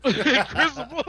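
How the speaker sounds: "Incrisible" said laughing, high and loud. Real, not acted. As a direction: laugh through the word "Incrisible".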